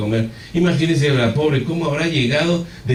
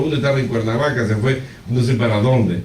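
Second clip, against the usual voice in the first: ordinary delivery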